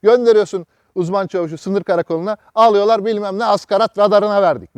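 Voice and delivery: normal talking voice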